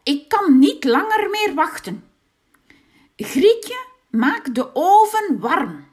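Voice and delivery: loud, high impatient witch; a fed-up witch barking orders. A narrator's reading. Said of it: "was de heks het beu". (ongeduldige heks, luid en hoog)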